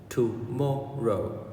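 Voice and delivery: TOMORROW broken slowly into separate syllables; "Tomorrow" drawn out with a small gap between syllables. Shown as a demonstration.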